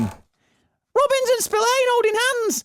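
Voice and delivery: very high mocking singsong taunt; taunting bully. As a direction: sneer it in a shrill mocking falsetto. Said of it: "the class bully storms in".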